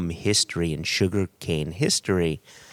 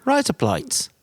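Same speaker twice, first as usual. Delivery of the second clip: high mock Australian "rise up lights"; a pointed Australian twang; Australian accent; 9 semitones higher than usual. Answering the co-host's correction, mock Australian accent. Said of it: "I thought you said rise up lights".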